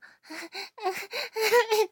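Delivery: high, loud strangled squeal of pain; a sudden high yelp, half laugh, half pain; strange pained squeal. Retold or imitated. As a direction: let out a weird yelping cry. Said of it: "変な声しか出なくて".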